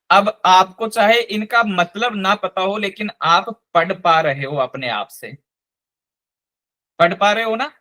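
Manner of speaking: ordinary talk, nothing marked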